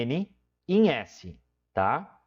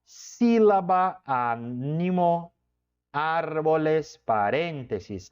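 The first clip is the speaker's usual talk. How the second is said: syllable-by-syllable; much slower than usual. Shown as a demonstration. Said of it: "Ó, sílaba".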